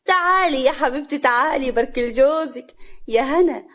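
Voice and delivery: crowing invitation to congratulate; gleeful summons. A narrator's reading. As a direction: call her over loudly, stretching it. Mother-in-law gleefully summons the wife.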